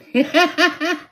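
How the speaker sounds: high, loud burst of laughter; sudden high cackle of amusement. Real, not acted. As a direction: laugh out at your own joke. Laughing at her own joke.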